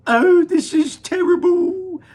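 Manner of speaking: high, slow, loud lament; the mother wails over her tiny son; wailing. Acted. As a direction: wail it in despair. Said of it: "wailed Missus T V".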